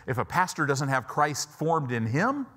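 ordinary talk, nothing marked

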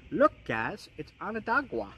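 put-on Onondaga said the old Long Island way, ending "Onondagwa"; old Long Island accent. Shown as a demonstration. Showing viewers his old way of saying the rock name, in a Long Island accent. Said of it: "how I used to say it with my Long Island accent".

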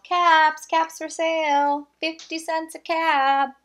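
high, loud calling out wares; street vendor's call. Acted. Peddler calling to passersby in the street, loud and high. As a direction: sing out the caps for sale. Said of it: "he called".

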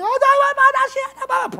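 very high, loud mock wail of no; hammed-up panicked mother; frantic praying mom. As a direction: imitate mom wailing in desperate prayer.

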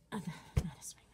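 whispered self-correction under her breath; embarrassed whisper. Real, not acted. Host whispers to herself after a slip, embarrassed. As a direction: hush it, caught saying the wrong word. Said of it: "I have to watch what I say".

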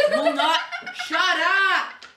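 laughing through the complaint; giggling it out loud; a laughing, mock complaint. Real, not acted. Laughing complaint about the card game.

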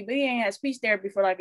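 ordinary talk, nothing marked

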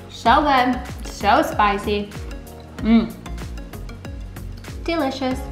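usual speaking voice

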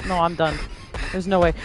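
ordinary delivery

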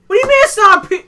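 incredulous. Real, not acted. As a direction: sound like you can't believe it. Incredulous at the claim.

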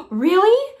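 breathless high Really; excited gasp. Acted. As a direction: burst out in delighted disbelief. Kids hear good news, gasp.

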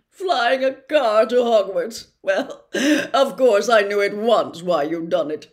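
a vain celebrity professor, loud and chummy; booming, self-satisfied charm; Lockhart voice. Acted.

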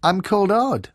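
put-on high, loud boyish shout. A narrator's reading. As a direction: call it up to the giant. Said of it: "shouted Odd".

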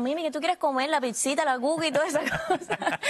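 breezy Cuban lunch lady, laughing at the end; Cuban accent. Retold or imitated. A hint of Cuban accent, cafeteria girls offering food to student Mimi. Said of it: "me decían".

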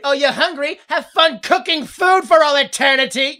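loud, high sarcastic gloat; mocking Serling taunt. Serling answering a hungry wisher, mocking. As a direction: jeer it like a cruel wish-granter.

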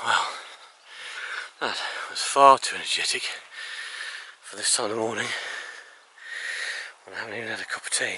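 puffed out from a hill climb; real huffing, words between breaths. Real, not acted. Vlogger remarking on the view after climbing the hill, out of breath. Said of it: "You know how out, out of breath I am".

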